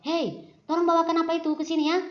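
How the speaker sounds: loud, high and fast, bossing a friend around; rude bossy command. Shown as a demonstration. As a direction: bark the order at a classmate without any courtesy. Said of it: "Jangan seperti itu".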